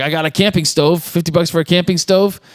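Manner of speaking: mock pleading, rising at the end; hopeful pleading. Desperate man offering the mechanic his camping stove, acted high and pleading.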